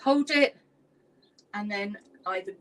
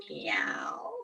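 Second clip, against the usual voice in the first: whispered meow. Pretend. Teacher leading cat stretches, whispered meow. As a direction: breathe a tiny cat meow. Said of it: "pretend to be a cat".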